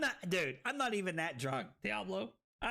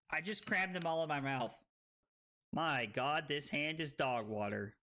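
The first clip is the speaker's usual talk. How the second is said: normal talking voice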